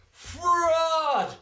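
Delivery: high, loud outraged shout; furious fans jeering the umpire; maddened crowd cry. Acted. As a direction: hurl the word at the umpire. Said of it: "cried the maddened thousands".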